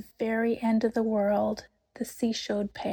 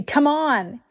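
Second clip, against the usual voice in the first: urgent call; 6 semitones higher, 6 dB louder than usual. A narrator's reading. Lucy urging Susan to run.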